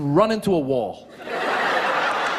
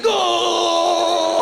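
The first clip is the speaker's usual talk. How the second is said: long, high goal call; orgasmic announcer; 10 semitones higher, 3 dB louder than usual. Acted. Comic mimicking a soccer announcer as they score, orgasmic drawn-out shout. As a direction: stretch it out like a climaxing commentator. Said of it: "the announcer is very orgasmic".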